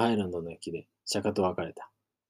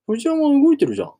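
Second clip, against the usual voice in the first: surprised; 11 dB louder than usual. Acted. Guests see ride moving alone.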